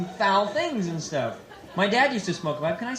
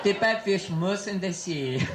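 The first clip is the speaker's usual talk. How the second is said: mock French-accented nature narration; low, measured documentary voice with a French accent; Jacques Cousteau; 3 semitones lower than usual. Explorer describing a sea creature to viewers, French accent. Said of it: "my name is Jacques Cousteau".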